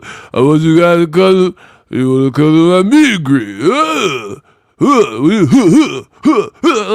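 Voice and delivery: mock Tasmanian devil babble, grunting between words; Clarissa Shields. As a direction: splutter and grunt in a hammed-up impression. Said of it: "conversation with Clarissa Shields and go like".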